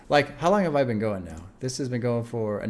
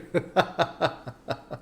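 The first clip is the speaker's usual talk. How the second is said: breathy, higher-pitched chuckling; laugh; 4 semitones higher, 4 dB quieter than usual. Real, not acted. Host amused by own mock scolding, laughing. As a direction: break into a run of chuckles.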